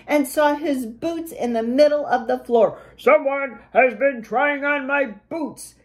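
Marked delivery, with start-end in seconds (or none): 3.0-5.7 big bear voice, indignant; booming papa bear. A narrator's reading. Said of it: "he boomed in his big bear voice".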